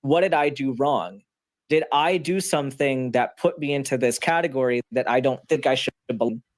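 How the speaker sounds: ordinary talk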